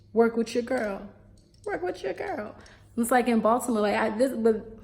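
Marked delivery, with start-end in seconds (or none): 1.6-2.5 much higher and softer plea; high coaxing repeat. Acted. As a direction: coax them in a small high voice. Pleading with pupils, second take.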